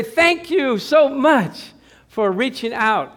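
heartfelt, drawn-out high thank-you; effusive thanks. Acted. Convert thanks the lady in heaven, effusive and slow.

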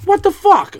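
high, loud shocked outburst. Retold or imitated. Told the dog died overnight, high shocked outburst. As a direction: blurt it out in shock. Shock at dog's death news.

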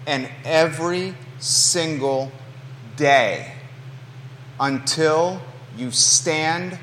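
every word spaced and slow; slow emphatic warning. Acted. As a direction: drag it out, word by word.

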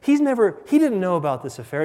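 high-pitched, hurried-to-correct voice; mock high female protest; flustered woman confessing. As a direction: imitate a woman rushing to clear someone. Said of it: "And she goes".

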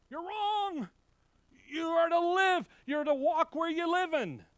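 loud, high-pitched preacher's shout; emphatic rebuke. Acted. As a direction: ring out the correction at full voice. Emphatic correction after buzzer.

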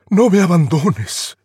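a hint of a loud, slow pleading prince; lightly voiced prince begging her to stay; mild mock plea. Prince cries out.

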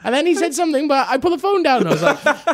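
very high, loud mocking posh-kid voice; whiny posh youth. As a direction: imitate an overexcited posh boy.

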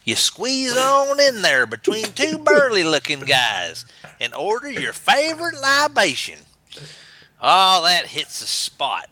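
high, swooping Southern US storyteller; Southern American accent. Acted. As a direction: spin a yarn in an Alabama accent. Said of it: "Southern American accent".

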